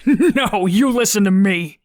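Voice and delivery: loud, high, words bursting through laughter; howling with laughter. A narrator's reading. Derp mocking the cadet's threat, howling with laughter. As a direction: hoot it out, helpless with laughter. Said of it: "through his howls of laughter".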